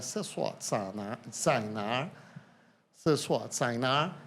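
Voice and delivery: slow, halting attempt at Mandarin "se sua tsainar"; sounding out clumsy Mandarin, slowly; broken Mandarin. Foreigner asking a waitress for the toilet in clumsy Mandarin.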